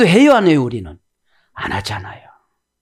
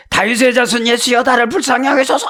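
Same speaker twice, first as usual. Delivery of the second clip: a higher pleading call to Jesus; beggar crying out; 8 semitones higher than usual. Retold or imitated. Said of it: "여기도 막 그래요". (예수께 부르짖는 거지 소경)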